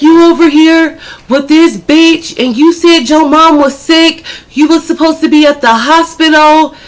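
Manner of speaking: imitated high-pitched accusing rant; angry confrontation. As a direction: lay into him like a betrayed girlfriend. Girl confronts cheating man while hitting him.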